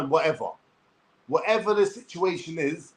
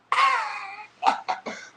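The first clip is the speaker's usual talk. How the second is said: bitter laugh. Real, not acted. Laughs at own irony.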